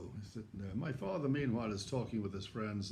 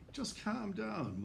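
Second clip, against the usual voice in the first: soothing; 10 semitones higher, much slower than usual. Retold or imitated. Father soothing panicked son.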